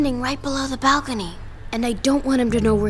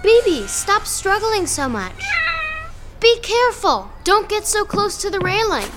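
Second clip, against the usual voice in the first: alarmed; 8 semitones higher than usual. Acted. Cat struggling near the railing.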